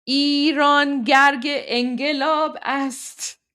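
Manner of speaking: slow drawn-out model, گرگ with hard g; hard G substitute. Shown as a demonstration. Showing viewers the chorus, slowly, hard g in گرگ. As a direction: stretch ایران, hard g in گرگ and انقلاب. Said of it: "that's how you would pronounce".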